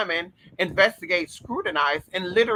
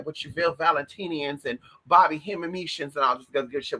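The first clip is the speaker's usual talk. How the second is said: ordinary talk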